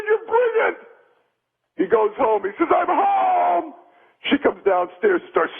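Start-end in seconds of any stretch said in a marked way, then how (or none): 0.0-0.8 high-pitched burst of admiration; acted delight. Joke's husband praises friend's plan. 2.7-3.7 high, drawn-out homecoming call. Acted. Joke's husband calls out arriving.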